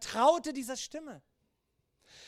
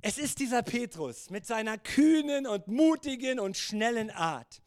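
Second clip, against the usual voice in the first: normal talking voice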